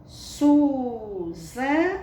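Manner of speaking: SUZANA very slow, SU and ZA drawn out, then NA; syllable by syllable. Shown as a demonstration. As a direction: sound out SUZANA in stretched syllables. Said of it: "já se torna uma sílaba".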